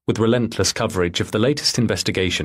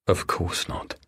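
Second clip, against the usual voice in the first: softer, whispered echo to himself; bitter whisper; 2 semitones lower, 6 dB quieter, slower than usual. Acted. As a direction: whisper it back, hurt. Paul echoing her to himself.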